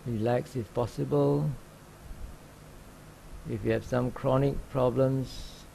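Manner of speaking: calm, even meditation guide with long pauses; unhurried instructions, leaving long silences between phrases; guided meditation. Real, not acted. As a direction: gently guide sitters into open awareness. Leads a sitting meditation.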